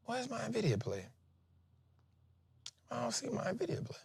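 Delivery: muttering, confused; muttering to self. Real, not acted. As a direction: mumble while hunting the chart. Searching chart, muttering to self.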